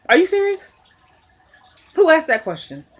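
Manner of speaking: high disbelieving repeat; incredulous. Real, not acted. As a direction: say it like you can't believe your ears. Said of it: "Which, are you serious?".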